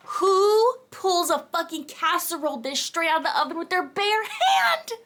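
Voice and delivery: high, climbing squeal of disbelief; incredulous shriek. Real, not acted. Reader yelling at the man with bare hand, incredulous shriek. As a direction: shriek it, drawing out the last words.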